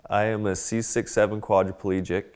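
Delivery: ordinary delivery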